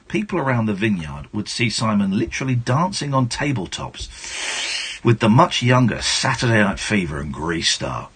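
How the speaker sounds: usual speaking voice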